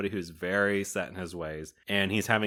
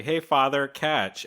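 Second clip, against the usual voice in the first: calling out; 6 semitones higher, 4 dB louder, slower than usual. Retold or imitated. Boys calling to the priest.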